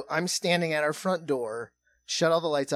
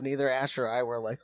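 usual speaking voice

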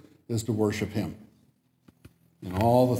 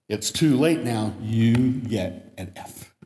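stern professor; slower than usual. Retold or imitated. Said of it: "Sproul replied".

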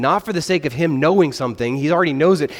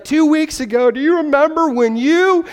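high, loud accusing whine; mock outburst; 11 semitones higher, 4 dB louder than usual. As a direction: hurl an old grudge at them. Friend finally explodes.

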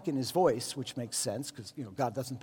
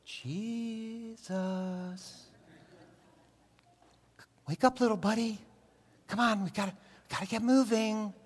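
soft, coaxing wake-up call; hushed, tender wake-up, like a parent at nap time; gentle waking; 5 semitones higher, 4 dB quieter than usual. Retold or imitated. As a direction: nudge a sleeping kid awake. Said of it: "like this, like".

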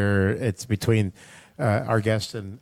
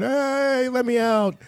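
high squeaky cat voice; 11 semitones higher, much slower than usual. Retold or imitated. Host voicing the cat begging to be let out, high and squeaky. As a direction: play the cat begging to get out. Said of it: "there's a cat over here going".

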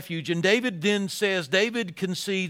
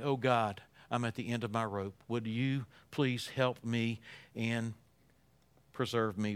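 hushed desperate prayer; 8 semitones lower, 10 dB quieter than usual. Acted. Softly voiced desperate prayer.

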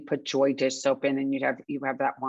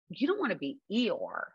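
ordinary talk

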